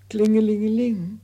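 high, loud sung ring-a-ling; imitated bell ringing.

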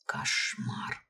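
whispered, appalled thought; hushed, voiceless horror; horrified whisper. A narrator's reading. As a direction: whisper it as a private realisation of dread.

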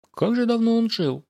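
high-pitched, sighed exclamation; a sighing, lifted remark; wistful sigh. A narrator's reading. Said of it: "вздохнул Карн".

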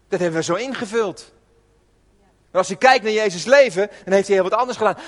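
ordinary talk

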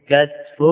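كتف as kat-fun; two-syllable كتف: KAT-fun; silent middle letter. Shown as a demonstration. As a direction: say كتف with a after kaf, no vowel on ta. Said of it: "هذه اللغات الثلاث".

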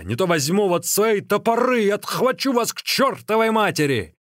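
high-pitched, scolding outburst; exasperated exclaiming. A narrator's reading. Runner curses his disobedient legs, exasperated exclaiming. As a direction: threaten your own legs, fed up. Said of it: "воскликнул Ликуй".

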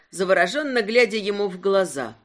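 plain reading voice

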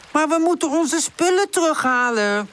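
high, urgent character voice; insistent pleading. A narrator's reading. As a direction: argue eagerly for going. Woezel argues for crossing.

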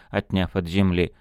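plain reading voice

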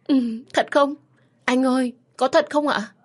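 begging the doctor to repeat the news; stunned joy. Acted. Wife asking the doctor again, high disbelieving joy. Wife asks husband if true.

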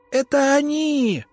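high, slow, terrified shriek; shrill shriek. A narrator's reading. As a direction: shriek it in panic. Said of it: "пронзительно завизжал ректор".

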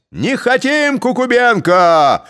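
loud high defiant yell; shout. A narrator's reading. Other Cossacks rejecting Kukubenko, shouting. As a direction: shout him down. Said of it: "кричала другая".

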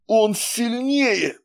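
high slow terrified cry. A narrator's reading. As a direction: cry out in terror. Fading bride cries in terror.

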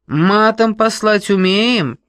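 loud brash woman; brash boss. Acted. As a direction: boom at her like a brash boss. Boss asks jokingly.

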